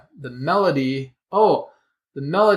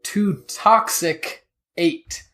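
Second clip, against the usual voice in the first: slow breakdown; 3 semitones higher, much slower than usual. Shown as a demonstration. Explaining the word's root to the cohost, slowly. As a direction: split "to toxic eight" into slow separate parts. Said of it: "the root of this is".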